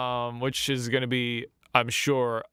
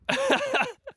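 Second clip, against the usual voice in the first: surprised laugh. Real, not acted. Reacting to a surprise chat.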